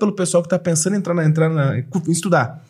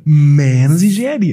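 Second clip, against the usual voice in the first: laughing mock dad; 6 dB louder, slower than usual. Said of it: "Você quer fazer belas artes?".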